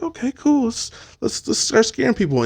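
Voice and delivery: high, light, game-for-it voice; playful high-pitched readiness; mock eagerness. Acts out agreeing to scare people.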